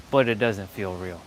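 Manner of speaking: ordinary talk, nothing marked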